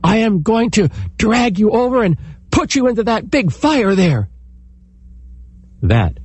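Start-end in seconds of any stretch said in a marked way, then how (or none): 0.0-4.3 threatening man. Acted. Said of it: "said the man".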